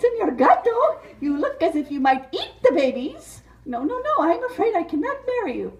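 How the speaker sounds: high, giggling refusal, fast; laughing Mariposa. Acted. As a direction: turn him down through laughter. Mariposa voice, held role.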